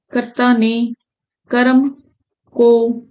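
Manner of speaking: usual speaking voice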